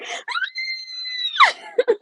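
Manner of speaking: very high, loud cry-laugh wail; a shriek of relief that breaks into tears; overjoyed sobbing holler. Real, not acted. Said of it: "I just started hollering".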